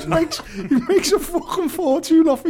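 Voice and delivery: high, real laughter through the words; laughing. Real, not acted. Friend teasing about the charm seller's earnings, high and laughing. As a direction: crack up while saying it.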